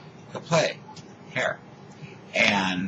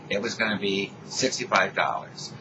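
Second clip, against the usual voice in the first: usual speaking voice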